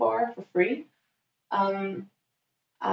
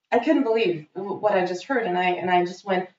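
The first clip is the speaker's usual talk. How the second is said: normal talking voice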